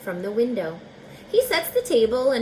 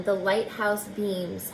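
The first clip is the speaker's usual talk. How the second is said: ordinary delivery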